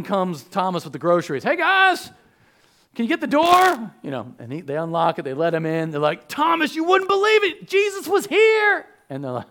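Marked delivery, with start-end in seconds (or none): 1.4-3.9 high, loud cheery call at the door. Acted. Thomas juggling groceries, calls up loud and high. 6.3-8.9 high, loud, breathless excitement; excited news. Acted. Disciples gushing to Thomas, high and loud.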